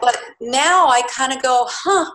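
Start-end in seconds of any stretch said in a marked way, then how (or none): 1.7-2.1 high, musing little huh; sanguine wondering. Acted. Looking back on her anger after menopause, sanguine wondering. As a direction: ponder it with fresh curiosity. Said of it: "With a little more sanguine postmenopausal thought".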